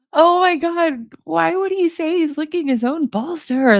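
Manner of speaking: high, disbelieving reaction; can't believe what she just read; real high-pitched disbelief; incredulous. Real, not acted.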